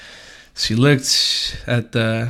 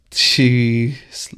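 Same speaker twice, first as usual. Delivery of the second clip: normal talking voice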